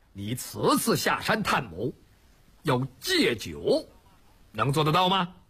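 low, slow, deliberate order; grave voice of an elder chief; stern, measured leader. Acted. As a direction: spell out the condition with drawn-out key words. Song Jiang sets first condition.

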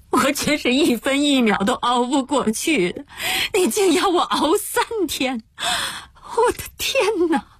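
sobbing, voice climbing very high; frantic crying. Acted. Locked-in addict told to endure three days, frantic sobbing. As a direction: wail in despair, slowing at the end. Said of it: "急得哭了起来".

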